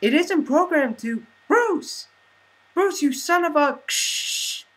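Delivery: shouting Carol. Acted. Carol screaming at Bruce over the radio, high and loud. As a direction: scream his name in panic.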